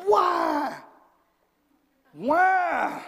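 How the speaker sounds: high, loud, drawn-out whine; mock childish wail. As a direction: wail like someone losing a prized toy. Acts a person refusing to let go.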